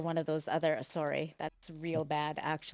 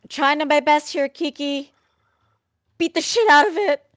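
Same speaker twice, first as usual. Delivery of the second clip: very loud, high-pitched effortful shout; frantic battle yell; 9 semitones higher, 12 dB louder than usual. Acted. Fighter telling Kiki she's trying her best, loud high yell. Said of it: "I'm, like".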